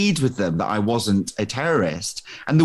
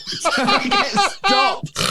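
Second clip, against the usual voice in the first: high, slow words through laughter; laughing; much slower than usual. Real, not acted. Guest retelling his terrorist mix-up, laughing through the words. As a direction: say it while still laughing. Laughing while telling story.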